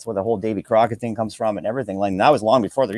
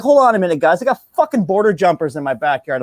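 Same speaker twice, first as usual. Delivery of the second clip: worked-up friend; 5 semitones higher, 4 dB louder than usual. Retold or imitated. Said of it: "He's like".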